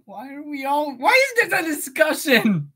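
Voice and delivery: high, exasperated whine. Real, not acted. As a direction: whine at chat in frustration. Exasperated outburst.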